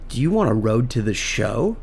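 low, softly dangled invitation; acted soft, low tease; soft-spoken offer. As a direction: make the offer quietly, temptingly. Retold enticing offer, first asking.

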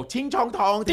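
high mock-Chinese syllables; caricature Chinese accent. Showing the wrong way to do it, caricature Chinese. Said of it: "We're not talking about Bugs Bunny nineteen forty".

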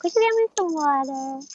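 put-on high, sweet little-kid plea; childlike begging voice. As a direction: ask like a tiny child wheedling a grown-up. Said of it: "I remember doing little kids' voices".

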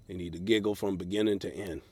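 ordinary talk, nothing marked

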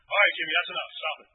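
loud, firm command; firm, not yelling. Retold or imitated. Father firmly orders his wailing son to stop, loud. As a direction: put your foot down hard. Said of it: "Just not yelling, but firm".

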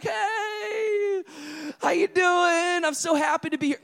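cheerful oblivious greeting. Retold or imitated. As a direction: wave back like a happy celebrity. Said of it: "I'm just waving back".

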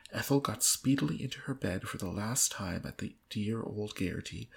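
ordinary talk, nothing marked

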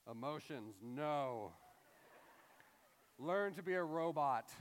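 mock stern, heartless father; hammed-up unfeeling dad laying down the law; jerk dad. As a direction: put on a dismissive dad voice. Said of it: "What kind of jerk dad is like".

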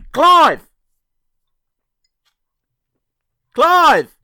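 loud call across the studio. Acted. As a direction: shout the name to summon him. Calling out to absent guest.

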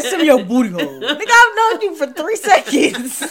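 loud, high laughing disbelief; 1 laughing mock outrage. Real, not acted. Mocking a horny guy's message, loud laughing. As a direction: laugh at how absurd it is.

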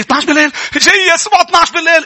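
high, loud imitation of an upset woman; retelling a tearful sister. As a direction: insist anxiously as the sister. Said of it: "قلت لي". (تقليد امرأة مضطربة تلحّ)

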